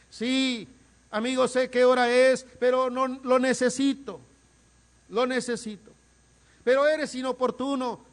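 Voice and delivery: loud, high, impassioned preaching; fervent preaching. Acted. Preacher pleading before his congregation, fervent and loud. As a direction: preach it out with full fire.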